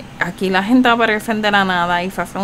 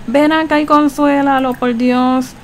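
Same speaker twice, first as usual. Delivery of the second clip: higher and a bit louder, begging; for heaven's sake, come comfort him; urgent plea; 5 semitones higher, 3 dB louder, slower than usual. Real, not acted. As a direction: call out pleading for someone to help. Urging Sim to comfort friend.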